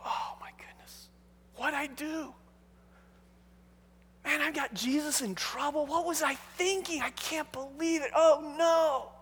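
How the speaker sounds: soft, fretful aside to himself, starting near a whisper; hushed dismay. Acted. Matthew fretting that he got Jesus in trouble, hushed dismay. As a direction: murmur it in mock panic, horrified at yourself.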